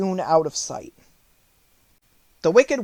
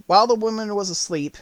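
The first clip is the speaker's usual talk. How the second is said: plain reading voice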